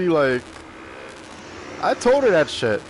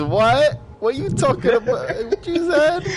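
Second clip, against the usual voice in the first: mock incomprehension; 8 semitones higher than usual. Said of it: "all I hear is".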